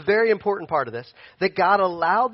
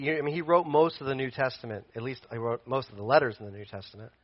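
usual speaking voice

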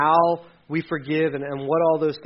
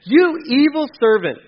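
higher, louder scolding; angry rebuke; 7 semitones higher, 6 dB louder than usual. Acted. King rebuking the unforgiving servant, angry. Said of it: "the king called in the man".

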